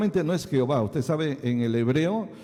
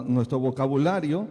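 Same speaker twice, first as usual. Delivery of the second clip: ordinary talk, nothing marked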